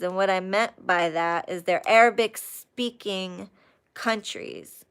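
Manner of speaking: ordinary talk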